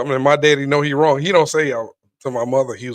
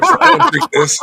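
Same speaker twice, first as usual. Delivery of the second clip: haughty rejection; 12 semitones higher, 4 dB louder, slower than usual. Retold or imitated. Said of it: "throw it".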